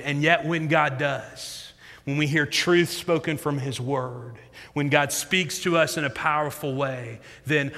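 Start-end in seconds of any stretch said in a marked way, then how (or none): none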